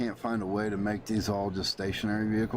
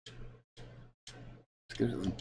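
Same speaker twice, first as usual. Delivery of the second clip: whispered to himself; 9 dB quieter, much slower than usual. Real, not acted. Mutters decision to self.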